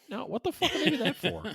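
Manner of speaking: high-pitched mock outrage; high, incredulous retold retort; mock incredulous wife. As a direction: imitate a wife balking in disbelief. Wife's shocked reply, acted.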